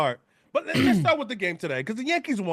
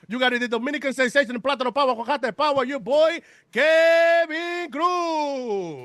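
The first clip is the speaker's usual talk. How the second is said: drawn-out ring announcer hype; lucha libre announcer; 5 semitones higher than usual. Acted. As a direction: stretch the name like a wrestling intro. Hyping intro of Kevin.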